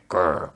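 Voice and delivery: short low grr; playful growl. Shown as a demonstration. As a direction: growl like a grumpy dog. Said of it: "I just randomly growl".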